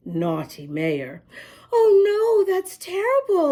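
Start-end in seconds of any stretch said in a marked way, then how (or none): 1.7-3.5 put-on high, bright girl's voice; lightly voiced little girl, dismayed; a hint of a girl's voice. A narrator's reading. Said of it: "a little girl said".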